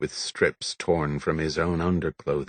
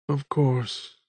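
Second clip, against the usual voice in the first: ordinary delivery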